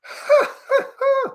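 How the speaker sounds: high-pitched burst of laughter. Real, not acted. Streamer laughing at a troll in the chat, high laugh. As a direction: crack up in a high, helpless laugh.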